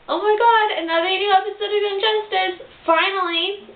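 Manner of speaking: high, bright excited opening; gushing fangirl greeting; excited squeal. Real, not acted. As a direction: burst in thrilled about the new episode. Excited opening of episode review.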